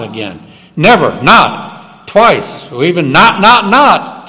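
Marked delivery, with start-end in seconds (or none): 0.8-2.1 Never, not drawn out slowly with weight; stress on Never. Shown as a demonstration. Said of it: "uses a word twice for emphasis". 3.1-4.3 not, not, not each punched, rising higher; emphatic repetition. Shown as a demonstration. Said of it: "three times".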